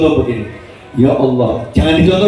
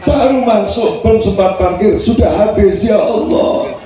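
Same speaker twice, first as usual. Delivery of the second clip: desperate prayer; 6 semitones higher than usual. Shown as a demonstration. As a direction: demo prayer, desperate complaint building to a cry. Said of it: "yang nggak utama dulu".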